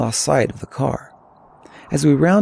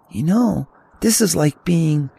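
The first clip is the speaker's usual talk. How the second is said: put-on higher-pitched teenage hiker; young teenager; 5 semitones higher than usual. A narrator's reading. Teen hiker to his older companion, higher boyish musing.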